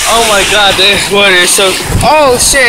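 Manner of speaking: loud, high flustered panic; panicked. Real, not acted. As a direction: yelp as the wave rushes in. Cold wave catching him.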